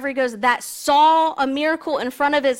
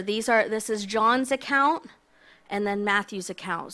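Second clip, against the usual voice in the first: normal talking voice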